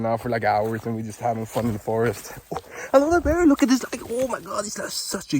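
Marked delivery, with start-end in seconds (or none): 2.5-5.4 high, louder thrilled gushing; excited squeal. Real, not acted. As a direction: gush over the berry. Excited at a huge berry.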